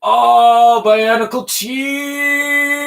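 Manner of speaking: slow, high, drawn-out exclamation; excited cry. Real, not acted. Builder celebrating the cheese piece, slow high cry. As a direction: stretch it out in delight.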